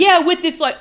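usual speaking voice

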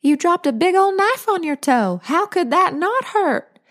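high, fretting grandmother voice; alarmed older woman. Acted. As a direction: fuss over her, pitched high.

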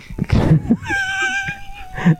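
helpless high laugh; squealing laughter. Real, not acted. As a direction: crack up. Breaking up laughing.

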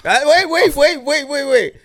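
excited stop; urgent interruption. Real, not acted. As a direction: cut everyone off urgently. Stopping himself mid-story.

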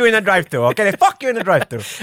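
lightly voiced indignant movie sidekick; a ranting sidekick catchphrase, hammed up; Leo Getz imitation. Quoting the film character to the co-host, mock rant. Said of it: "Leo imitation".

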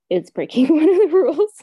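amused laugh about the forbidden cookie. Real, not acted. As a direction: burst into a real laugh on it.

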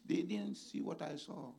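ordinary delivery

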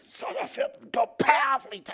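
high, overcome squeal; hammed-up high-pitched rapture; mock religious ecstasy. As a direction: mimic a believer overcome by the Spirit. Mocks an overexcited anointed believer.

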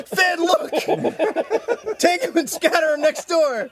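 put-on high, loud call to the kid; gleeful mock shout. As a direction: holler it in mock excitement, laughing. Acting out urge in pet store.

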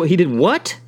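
ordinary talk, nothing marked

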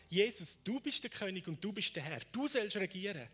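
insistent, loud appeal in prayer; fervent prayer. Real, not acted. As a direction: cry out to God with urgency.

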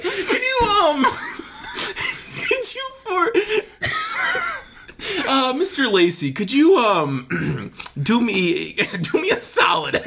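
high, laughing mock prosecutor questioning; prosecutor impression. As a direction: play a giggling courtroom prosecutor. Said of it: "this is your prosecutor impression".

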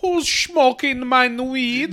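put-on high, drawn-out German accent; Hans. Co-host joins in as the German sleeper, indignant German accent. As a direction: ask it slowly in a put-on German voice. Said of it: "Yeah, Hans".

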